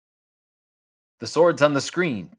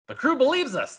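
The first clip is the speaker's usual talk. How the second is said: put-on child voice; 12 semitones higher than usual.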